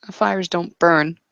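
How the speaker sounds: normal talking voice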